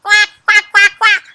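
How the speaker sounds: loud, high duck quacks; big sharp imitated quacks; duck quacking. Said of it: "I heard duck sounds".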